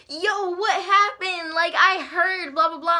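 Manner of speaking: high, slow, drawn-out excited imitation; nosy onlookers. Imitating people flocking over after the fight, excited nosiness. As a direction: stretch it out high and eager.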